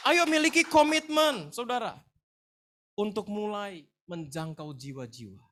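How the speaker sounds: slow, high preacher's shout easing off; drawn-out, loud pulpit cry, then settling; rallying cry. Real, not acted. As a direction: call the whole congregation to action.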